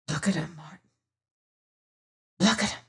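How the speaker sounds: hushed, awed whisper over the gems. A narrator's reading. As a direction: breathe it softly, spellbound. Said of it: "he whispered".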